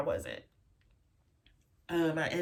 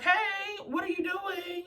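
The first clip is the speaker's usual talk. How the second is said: much higher, bright mock-cheerful greeting; jolly mom; 12 semitones higher than usual. Mom picking up her call, imitated as jolly and high. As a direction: imitate mom answering the phone all cheery. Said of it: "she's all jolly".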